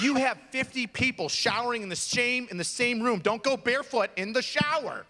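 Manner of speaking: high, insistent rant; exasperated warning. Real, not acted. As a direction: lay down the rule emphatically. Ranting warning about shower hygiene.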